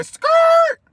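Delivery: high, loud tire skid sound; tire screech. Retold or imitated. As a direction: screech it like brakes slamming. Imitating car tires screeching.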